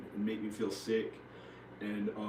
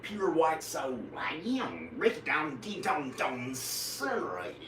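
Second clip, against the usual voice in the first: drawn-out caricature of a bigot; slow, silly bigot impression; 4 semitones higher, slower than usual. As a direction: stretch the words in a hammed-up put-on voice. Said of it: "act like a racist who talks that way".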